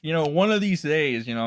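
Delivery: ordinary delivery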